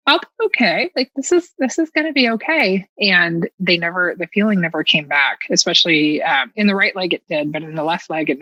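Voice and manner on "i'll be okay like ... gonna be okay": high, bright self-reassurance; forced optimism. Retold or imitated. Woman with numb legs reassuring herself, high and upbeat. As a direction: talk yourself into being fine. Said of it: "but I thought".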